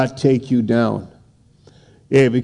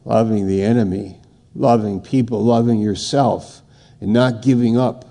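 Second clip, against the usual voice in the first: ordinary talk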